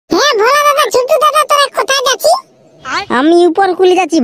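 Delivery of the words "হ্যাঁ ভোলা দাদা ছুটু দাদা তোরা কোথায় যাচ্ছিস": put-on high, young kid's voice; child's voice. Little kid asking Bhola where they're going, in a high child's voice. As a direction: play a little kid calling after the big boys.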